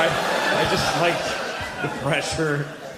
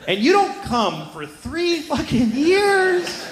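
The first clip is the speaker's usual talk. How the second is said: high, slow, cracking comic wail; strained near-crying whine; mock anguish; much slower than usual. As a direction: wail it like a tortured kid. Anguished boyhood complaint.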